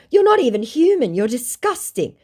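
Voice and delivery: high, loud start, slowing on the insult; disgusted outrage. Retold or imitated. Woman telling a scammer what he is, disgusted.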